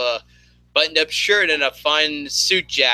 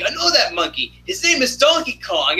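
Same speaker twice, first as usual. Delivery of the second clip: Fry voice; 10 semitones higher than usual. Retold or imitated. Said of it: "Fry's all like".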